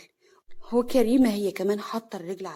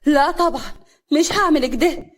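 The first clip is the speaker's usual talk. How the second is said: louder, higher indignant refusal; refuse, outraged and shocked; angry shock; 5 semitones higher, 7 dB louder than usual. Acted. Hana refusing the humiliating order, angry and shocked. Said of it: "هنا بغضب وصدمة".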